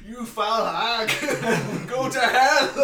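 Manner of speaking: furious high Northern Irish yell, slow; angry Northern Irish rant. Retold or imitated. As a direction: scream it like an enraged Northern Irish politician. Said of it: "I can't do a Northern Irish accent".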